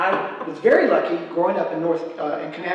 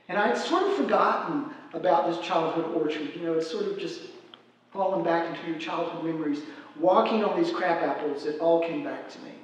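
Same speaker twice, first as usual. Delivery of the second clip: normal talking voice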